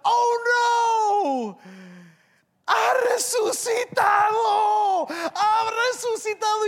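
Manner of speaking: high, loud, drawn-out wail; hammed-up cry of alarm; panicked tomb. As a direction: shriek the dreadful news in mock horror. Tomb cries out the resurrection.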